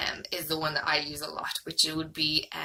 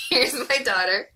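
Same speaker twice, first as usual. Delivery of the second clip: laughing; 8 semitones higher, 7 dB louder than usual. Real, not acted. Daughter climbs in for cuddle.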